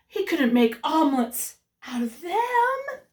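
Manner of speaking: plain reading voice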